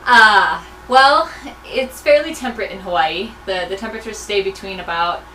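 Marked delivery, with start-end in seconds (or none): none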